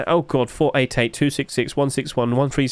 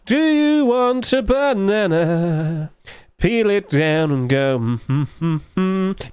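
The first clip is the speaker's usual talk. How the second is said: playful bit; 3 semitones higher, much slower than usual. Pretend. Mock banana eating bit.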